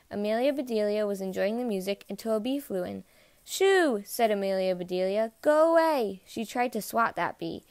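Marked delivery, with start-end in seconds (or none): 3.4-4.0 high, loud, drawn-out shoo; shooing cry. A narrator's reading. Amelia swats at a bee, high shooing cry. 5.4-6.2 high, loud call to drive it off; shooing cry. A narrator's reading. Amelia swats at a bee, loud shooing cry.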